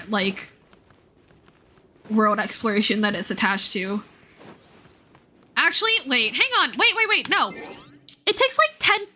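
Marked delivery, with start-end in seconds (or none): 5.6-9.1 sudden realization. Real, not acted. Streamer realizes the quest takes forever, surprised and much higher. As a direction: pitch jumps much higher, rapid "wait, wait, wait".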